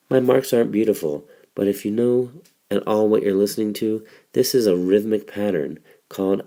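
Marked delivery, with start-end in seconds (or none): none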